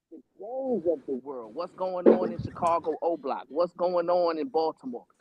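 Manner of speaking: ordinary talk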